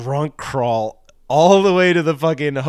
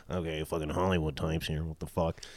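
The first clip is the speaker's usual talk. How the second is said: muttering locals; 7 semitones lower, 10 dB quieter than usual. Retold or imitated. Locals mocking the Hollywood types.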